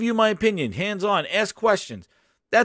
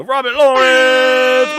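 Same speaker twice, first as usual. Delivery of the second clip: announcer callout; 7 semitones higher, 8 dB louder, much slower than usual. Acted. Announcing new member, drawn out.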